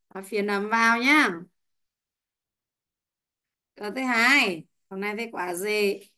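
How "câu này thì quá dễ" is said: ordinary talk, nothing marked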